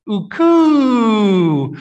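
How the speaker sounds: uku stretched high and loud; far-off uku. Retold or imitated. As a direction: call "uku" long and high, meaning someday far away. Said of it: "it's uku".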